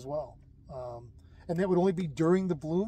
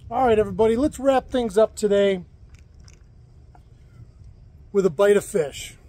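louder on-camera presenter voice; cheerful cooking-show host, louder than the chat; upbeat host to camera; 4 semitones higher, 11 dB louder than usual. Acted. Host wraps up episode.

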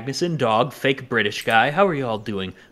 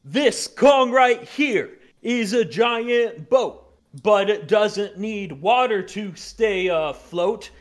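slow, sing-song poem reading; slow rhyming recital; 7 semitones higher, slower than usual. Acted. Recites rhyme about the ship.

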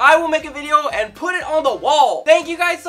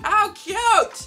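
ordinary delivery

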